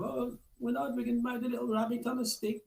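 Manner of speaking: high, soft child's voice, imitating a kid. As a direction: chatter it like an excited little kid. Kid voiced telling other kids.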